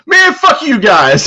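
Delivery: very high, loud, slow hammed-up huff; mock indignant outburst. Leon curses the laughing locker room, hammed-up huff. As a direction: storm off insulted, overplayed. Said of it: "And he goes".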